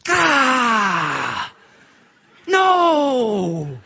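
high, loud wailing no; anguished cry of no. Retold or imitated. Preacher reliving the unwanted job offer, wailing no. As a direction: cry out in dismay.

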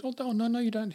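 high-pitched retold protest; polite protest. Woman refusing a stranger's offer of a drink, high protest. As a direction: imitate her refusing the offer, pitched well up.

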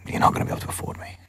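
imitating a fast, hushed aside; confidential whisper. Star to producer after the screening, imitated confidential whisper.

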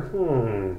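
long drawn-out pondering hmm. Acted. Acting out a reply to a serious question, pondering. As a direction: mull it over with a drawn hmm. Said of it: "after pondering".

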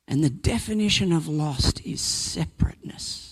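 hushed whisper on a key line; whispered sermon emphasis; whispered point. Real, not acted. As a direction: drop to a whisper for weight.

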